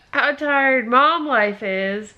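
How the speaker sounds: laughing through tears, slow; crying laugh. Real, not acted. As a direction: voice cracking into a sob. Tired mom breaks down.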